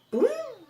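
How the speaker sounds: high mock pop noise; eye pop sound. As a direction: squeak out the eye twitch. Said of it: "fazia assim, ó". (som de pum agudo)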